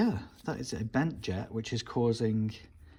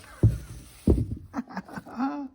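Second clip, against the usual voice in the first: high breathy giggle; giggling laugh; 6 dB louder than usual. Real, not acted. Joking about Dirty Garage Guy, high breathy giggle.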